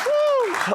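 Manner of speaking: high, loud whoop; excited whoop. Real, not acted. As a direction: let out a big cheer. Host whoops to crowd.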